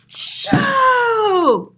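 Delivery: high, loud hammed-up gasp; gasping sneeze build-up. Reading aloud, acting Louise's gasp before the sneeze. Reader acts the sneeze wind-up.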